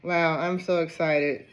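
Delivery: usual speaking voice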